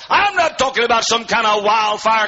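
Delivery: shouted, high, chanting preacher's climax; preaching at full force. Acted. Preacher crying scripture to his church, sung and shouted. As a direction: lift the whole room with it.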